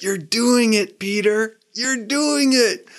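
put-on high, drawn-out boyish cheer; delighted cheering. Lost Boy as Peter figures it out, delighted cheering. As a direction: cheer him on in a high, amazed voice.

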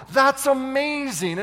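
loud, high, drawn-out marvelling; amazed crowd reaction. Acted. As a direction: exclaim it slowly in wonder.

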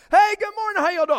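high, loud, bright greeting; cheery pastor greeting. Retold or imitated. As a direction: greet the arguing couple cheerfully. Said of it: "And pastor".

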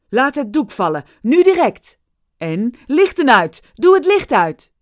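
loud, rising, frantic shout; shouting orders. Acted. Steven shouts orders to the crew, loud and frantic. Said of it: "Daarna riep hij".

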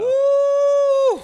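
high, loud whooping cheer; loud woo. Real, not acted. Celebrating after shot.

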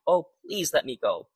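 storyteller's understated whine; whining sun. A narrator's reading. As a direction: lightly voice a whined plea. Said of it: "and the sun whined".

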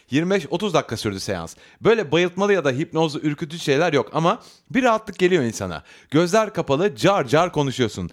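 reading a listener's letter, lively and animated; letter read aloud. Acted. Host reading a listener's letter to the audience. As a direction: tell her story like it's your own.

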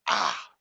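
sudden loud yelp of hurt; loud "ah" of pain in a story; cry of pain. Retold or imitated. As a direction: yell out as if falling and skinning a knee. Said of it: "and he says".

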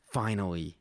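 ordinary delivery

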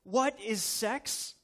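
put-on high, eager child calling for her daddy; a dad retelling his daughter running out to ask; little girl voice. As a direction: pitch way up like an excited small daughter, slowing on the question. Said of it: "and she goes".

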